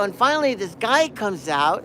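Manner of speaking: normal talking voice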